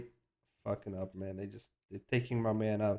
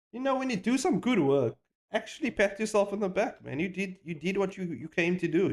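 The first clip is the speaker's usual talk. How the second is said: much higher, urging; earnest pep talk; 7 semitones higher than usual. Real, not acted. Vlogger to artists, pitch high and urging. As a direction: pump up fellow artists.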